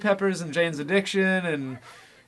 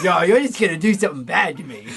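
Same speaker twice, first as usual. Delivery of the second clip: Homestar impression; 4 dB louder, faster than usual. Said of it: "He's like".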